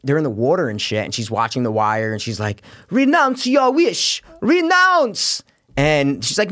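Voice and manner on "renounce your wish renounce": high, drawn-out mock command; high-pitched heroine impression, words stretched out; Wonder Woman. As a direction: imitate a film heroine's slow, pleading demand. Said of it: "listen to you do Wonder Woman".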